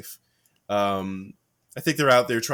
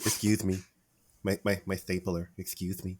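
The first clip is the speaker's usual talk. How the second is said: Office Space mumbler; 2 semitones lower than usual. Acted. Co-host quoting the stapler man from Office Space, meek mumbling. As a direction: mumble a timid complaint under your breath. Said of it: "trying to get a remake of Office Space".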